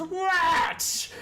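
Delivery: sharp high loud snap; irritated shriek. Acted. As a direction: whirl round and shriek at him. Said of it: "Ickett turns around and goes".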